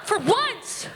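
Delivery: high-pitched mock outburst at mom; hammed-up exasperation; exasperated scolding. Retold scolding of her mother.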